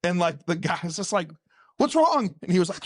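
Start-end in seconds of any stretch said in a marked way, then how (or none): 1.8-2.3 alarmed question. Retold or imitated. Passenger alarmed, retold.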